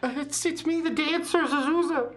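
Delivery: high-pitched dancer voice. Acted. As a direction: answer in her high voice.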